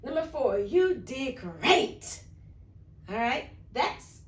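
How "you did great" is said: slow, drawn-out excited praise. Shown as a demonstration. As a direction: stretch it out with real enthusiasm. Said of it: "said with excitement".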